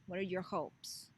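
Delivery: usual speaking voice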